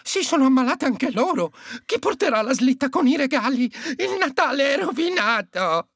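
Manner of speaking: high-pitched, dismayed elf voice; sulky elf lament. A narrator's reading. Elf fearing Christmas is ruined, high sulky lament. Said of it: "esclamò l'elfo imbronciato".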